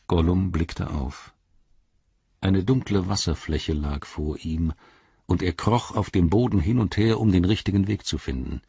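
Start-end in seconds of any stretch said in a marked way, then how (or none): none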